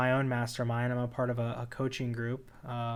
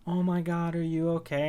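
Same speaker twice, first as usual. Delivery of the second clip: higher-pitched imitation of worried people; mock alarmed concern; 5 semitones higher, 4 dB louder than usual. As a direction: imitate someone reacting with mild alarm. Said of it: "I see a therapist, people".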